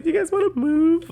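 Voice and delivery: high, squeaky mock waiter voice; mimicking the waiter's awkward question. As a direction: parody his polite offer, way up high. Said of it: "he just came over and was like".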